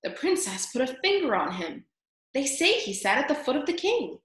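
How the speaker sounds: lightly voiced murmuring onlookers; gossiping crowd mice. A narrator's reading. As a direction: pass on a shocking rumour.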